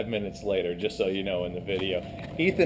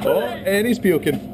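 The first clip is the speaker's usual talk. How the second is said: excited; 10 semitones higher, 5 dB louder, slower than usual. Real, not acted. Friend vomits after cinnamon challenge.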